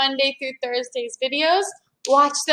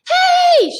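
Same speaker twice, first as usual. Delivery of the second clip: high, loud call across the plain; shout; 8 dB louder than usual. A narrator's reading. Annie calls to the Cro-Magnon family, loud shout. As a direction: yell to get their attention. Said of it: "shouted Annie".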